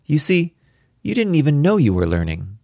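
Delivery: put-on high-pitched, teasing child's voice; impish little girl. A narrator's reading. Daughter revealing her father's secret lessons, impish. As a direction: let Daddy in on it, impishly. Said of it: "She looked at me impishly".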